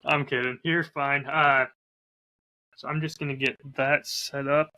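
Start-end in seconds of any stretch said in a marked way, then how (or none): none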